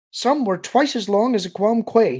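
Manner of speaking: high, loud alien voice; earnest young alien. Acted. Alien describes the branches to Luke, earnest. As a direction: report it emphatically, pitched well up.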